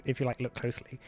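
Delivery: normal talking voice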